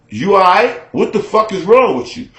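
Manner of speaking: a man startled by his ex's snappy tone on the phone; taken aback. Retold or imitated. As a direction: raise your voice in disbelief at her tone. Heated phone quarrel.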